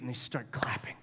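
hushed, breathy storyteller's whisper; dramatic whisper. Real, not acted. Pastor hushing the congregation before the big moment, whispered. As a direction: drop to a whisper to build suspense.

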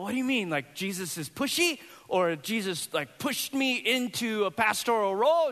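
high, bewildered questions; a confused dad trying to make sense of it; puzzled. Retold or imitated. Pastor retelling his puzzled questions to his daughter.

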